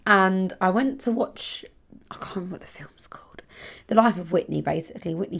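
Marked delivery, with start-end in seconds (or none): 2.1-3.4 fast whispered admission; a quick unvoiced whisper; whispered aside. Real, not acted. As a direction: rush it in a whisper. Aside, forgot the film name.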